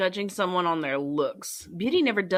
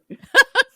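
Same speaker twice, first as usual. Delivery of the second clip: short squeal of laughter; a high, delighted laugh; 12 dB louder than usual. Real, not acted. Laughing at own joke.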